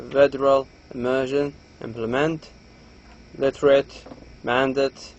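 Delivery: normal talking voice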